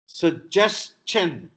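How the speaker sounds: suggestion spelled out slowly; slow syllable by syllable. Shown as a demonstration. Teacher slowing down to model suggestion. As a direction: stretch out every syllable of suggestion. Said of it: "the stress is on ja".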